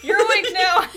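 high loud alarm through laughter; loud laughing voice. Retold or imitated. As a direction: shout yourself awake, laughing. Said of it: "my brain goes".

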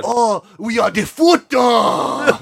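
high, slow, bad Japanese accent; hammed-up bad Japanese accent; terrible Japanese accent. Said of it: "speaking with a terrible Japanese accent".

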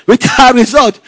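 said through a real laugh. Real, not acted. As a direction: laugh while you repeat it. Laughing about the results.

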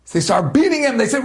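normal talking voice

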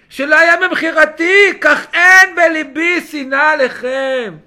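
loud, slow, drawn-out proclamation; full-force preaching. Acted. Preacher declaring Joseph holds no hatred for his brothers, loud declamation. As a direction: declaim each phrase forcefully.

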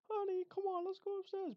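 very high-pitched mock American woman; American mother. American mother coaxing child upstairs, high put-on voice. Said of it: "the woman's actually American".

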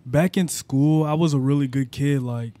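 ordinary delivery

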